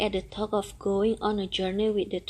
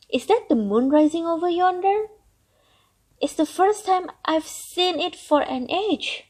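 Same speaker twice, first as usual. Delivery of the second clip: put-on delighted old turtle, higher and louder; gleeful turtle; 5 semitones higher, 5 dB louder than usual. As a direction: marvel at the world outside. Turtle's glee at escaping.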